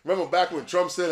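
normal talking voice

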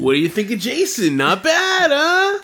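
hammed-up eager nudge, voice soaring at the end; playful mock pitch. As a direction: climb to a high, teasing finish. Acted sales pitch of hot friend.

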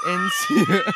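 laughing. Real, not acted. Sibling cracking up while naming the city.

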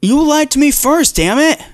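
high, loud pretend indignation; mock outrage. Playing betrayed partner to co-host, mock outrage. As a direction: throw the accusation back in hammed-up fury. Mock quarrel about lying.

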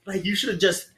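high, far-off mocking yell of other inmates. The other inmates yelling at the gassed man, high mocking jeer. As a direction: jeer like the whole block is mad at him. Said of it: "They're yelling at him".

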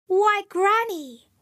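drawn-out, high-pitched storybook wonder; mock whispered wonder. A narrator's reading. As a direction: stretch it out high, falling at the end.

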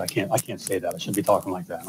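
a mock-guilty hush, voice dropped low; hushed self-quote. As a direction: mutter it like a confession. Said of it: "I said".

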